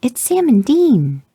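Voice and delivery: put-on high, slow awestruck child voice; a stunned little girl pointing it out; little girl piping up. A narrator's reading. Ten-year-old daughter telling her mother, high and slow. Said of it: "piped up from behind your seat".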